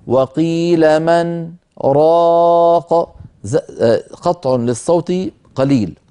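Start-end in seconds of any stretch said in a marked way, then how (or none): 0.1-3.2 very slow chanted recitation with a short silent pause on the نون of من; recitation with saktah. Shown as a demonstration. Teacher shows the brief break, in very slow chanted recitation. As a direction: chant slowly, break the sound briefly after من. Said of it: "هكذا".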